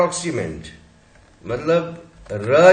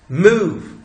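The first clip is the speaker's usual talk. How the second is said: मूव said with firm push and louder; pressed stress; 4 semitones higher, 6 dB louder, much slower than usual. Shown as a demonstration. Teacher to class, pressing the stress. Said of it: "थोड़ा जोर से pressure देके बोलना".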